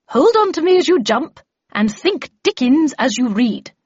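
loud, high, emphatic instruction; commanding Miss Havisham. Acted. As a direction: drill the apprentice before a leap.

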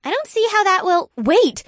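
rising pitch, breaking off in surprise; sudden realization gasp. A narrator's reading. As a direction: catch your breath on the realization. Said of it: "Janine gasped".

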